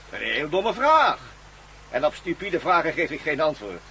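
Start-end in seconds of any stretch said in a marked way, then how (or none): 0.1-1.2 slow, drawn-out scorn; slow mocking repeat. Villain sneering at the captive's question, slow and scornful. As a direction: savour each word of the insult.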